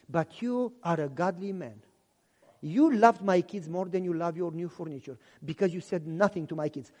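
divorced mother confessing to the pastor, soft and low. Retold or imitated. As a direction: admit it quietly, ashamed. Mother voiced praising him.

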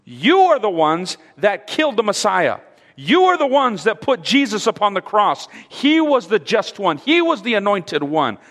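high, loud, hammering accusation; Stephen. Acted. Stephen accuses the leaders of killing Messiah, bold and harsh. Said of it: "What is Stephen telling them?".

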